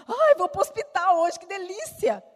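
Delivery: mock someone thrilled to go to hospital; sarcastic mock delight. Ironic imagined hospital-goer. (alegria fingida e irônica, aguda e alta)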